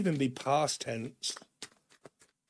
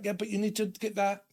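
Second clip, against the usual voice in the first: higher-pitched mock insistence; mock nagging; 7 semitones higher than usual. Nagging partner pressing someone about a mistake, mildly insistent. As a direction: lightly act out pestering someone.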